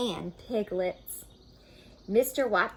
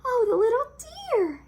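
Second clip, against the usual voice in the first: high, loud tender coo; 8 dB louder, faster than usual. Acted. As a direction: coo over the little piglet. Wife coos over piglet.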